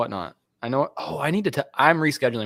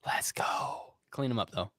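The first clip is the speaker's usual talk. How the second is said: whispering; 3 semitones lower, 12 dB quieter, slower than usual. Real, not acted. Whispering off to the side.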